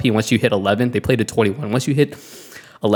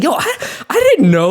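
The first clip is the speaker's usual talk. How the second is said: stunned disbelief; 13 semitones higher, 3 dB louder than usual. Retold or imitated. Stunned at his mistake.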